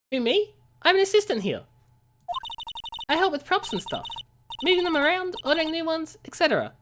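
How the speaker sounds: high, put-on girlish assistant voice; a deliberately poor high girl voice; bad young woman voice. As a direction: squeak it like a chirpy assistant. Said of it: "That's a really bad voice".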